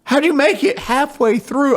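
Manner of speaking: very high, loud mock sergeant question; drill sergeant asking. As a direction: demand it in disbelief. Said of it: "They're like".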